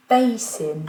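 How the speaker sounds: "Basin" in English, first syllable high and loud; stress on first syllable. Shown as a demonstration. Teacher modelling the English word, "Basin" stressed on the first syllable. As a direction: punch the start of "Basin" and let it fall. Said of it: "la prononciation par défaut".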